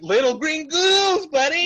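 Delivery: lightly played proud Charlie; a hint of Charlie's sincere oddball tone; quoting Charlie. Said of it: "He's like".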